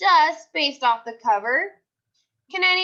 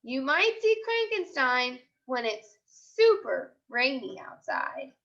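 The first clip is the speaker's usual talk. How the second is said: put-on lively storybook voice for young kids; picture book read-aloud; slower than usual. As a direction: read it like a class story time. Teacher reads picture book aloud.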